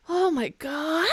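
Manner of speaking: high startled cry; shocked. Real, not acted.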